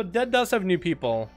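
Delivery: ordinary talk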